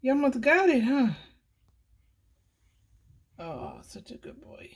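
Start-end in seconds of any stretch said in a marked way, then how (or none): 3.4-4.7 unvoiced whisper, tender. Real, not acted. Whispering praise to the cat at the window. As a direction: hush it like a secret.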